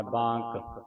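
ਬਾਂਕ with a long nasal 'aa'; ਬਾਂਕ set apart, long vowel before k; demonstrated long vowel. Shown as a demonstration. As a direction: softly model ਬਾਂਕ, holding the aa. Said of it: "ਬਾਂਕ ਪੜ੍ਹਨਾ".

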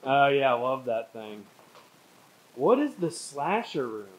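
ordinary talk, nothing marked

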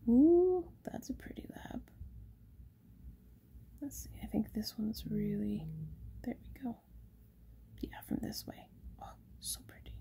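soft admiring whisper. Real, not acted. Shop owner admiring labradorite up close, whispering. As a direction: breathe it to the stone.

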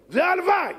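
sudden high, loud exclamation; emphatic cry. Real, not acted. Preacher to congregation, a sudden high loud cry. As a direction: burst out with the word.

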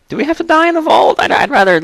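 put-on squeaky high-pitched child asking; high kid voice. Player voicing his character in a high child voice. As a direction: pitch way up like a whiny little kid.

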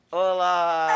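high, very loud excited hello; shouted greeting. Real, not acted. Host greeting the family as they join, excited shout. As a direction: burst out with a big drawn-out hello.

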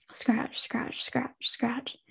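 soft whispered scratching. Acted. Unseen presence scratching at Casey, whispered. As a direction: breathe the scratches out quietly. Eerie scratching sound in dark room.

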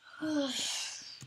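big audible yawn. Real, not acted. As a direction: let out a long yawn. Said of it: "Stop yawning".

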